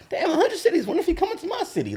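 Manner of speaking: high-pitched fan voice; excited fan. Retold or imitated. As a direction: squeal it like an eager fan. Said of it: "without somebody going".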